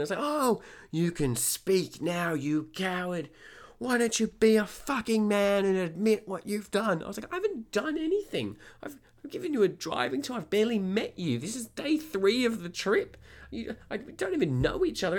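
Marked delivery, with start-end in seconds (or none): 0.2-7.0 high, scathing imitation of an irate customer; loud-edged, pitched-up mock fury; angry taunting. Retold customer taunting and berating him. 7.3-15.2 very high, exasperated self-defence; pleading innocence, voice shot way up; indignant protest. Retold or imitated. Teller retells his indignant protest.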